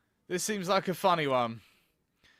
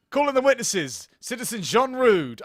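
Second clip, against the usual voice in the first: raised, higher courtroom summons; calling out; 5 semitones higher, 5 dB louder than usual. Acted. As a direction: call the witness in loudly. Voices court calling in witnesses.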